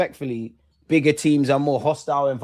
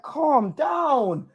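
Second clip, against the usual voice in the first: strained plea; 10 semitones higher than usual. Real, not acted. Fan begging team to calm.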